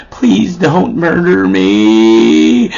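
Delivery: very drawn-out pleading; mock timid plea. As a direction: stretch out every word of the plea. Meek plea to huge man.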